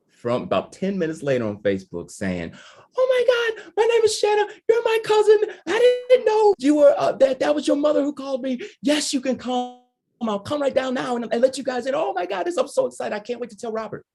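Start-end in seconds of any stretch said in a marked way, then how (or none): 3.0-14.1 much higher, gushing and breathless with joy; super excited cousin. Retold or imitated. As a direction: squeal it out like a thrilled long-lost cousin. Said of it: "she was super excited".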